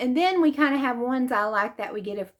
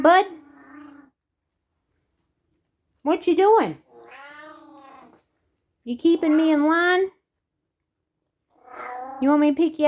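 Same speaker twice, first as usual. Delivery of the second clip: higher, louder coo to the cat; pet talk; 4 semitones higher, 3 dB louder than usual. Real, not acted. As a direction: sweet-talk your cat, sing-song. Talking to her cat.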